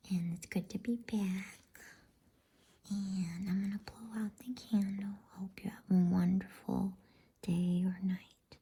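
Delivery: usual speaking voice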